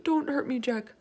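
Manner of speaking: high, whimpering plea; scared giant crying out; pleading yell. Acted. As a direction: beg in fright not to be hurt. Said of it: "he yelled".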